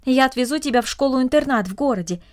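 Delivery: ordinary delivery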